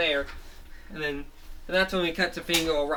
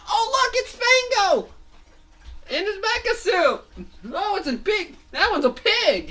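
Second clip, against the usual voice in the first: very high, excited squeals; giddy cartoon fans; squealing crowd; 5 dB louder than usual. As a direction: point and shriek at the pig. Crowd spots Fango's pig suit.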